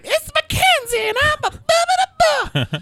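put-on very high, slow, laughing goofy girl voice; ditzy high squeak with a giggle; goofy Mackenzie. Host mocking the caller as a goofy girl. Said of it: "goofy, overalls in Alabama type".

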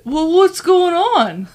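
slow, high, drowsy voice; groggy husband. Retold or imitated. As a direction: drag it out like someone just woken. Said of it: "he turned around and he said".

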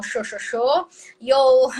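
"yo" pronounced "sho"; Rioplatense sh for y. Shown as a demonstration. Showing Argentine and Uruguayan speech, "yo" said as "sho". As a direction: slowly repeat "yo" with a sh sound. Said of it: "hablan como así".

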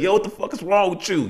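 high-pitched, rapid mock challenge; mock confrontation. Men clowning on each other instead of opening up, mock confrontation. Imitates men snapping at friend.

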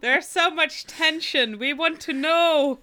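high, loud playful clamour; mock impatience. Waiting household desperate for the letter's news, mock impatience. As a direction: burst out in mock suspense.

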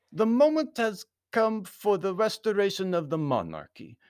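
high, pompous Baron's speech; formal foreign Baron. A narrator's reading. Baron confiding in Anthony about the monarchy, high formal voice. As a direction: proclaim it formally, pitched well up.

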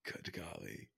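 breathless whisper. Real, not acted. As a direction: whisper it to yourself. Quiet exclamation.

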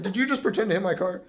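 imitated driver, played up loud; mocking retelling. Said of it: "he said the dumbest shit ever".

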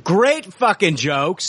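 high, drawn-out exasperation; exasperated lament. Real, not acted. Host mourning two hours of lost recording, exasperated. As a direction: wail it over what was lost.

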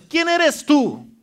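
slow, drawn-out high rebuke; defiant challenge. Shown as a demonstration. Preacher rebuking the mountain for the church, slow and loud. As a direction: hold each word and confront it. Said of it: "qué dice la palabra de Dios".

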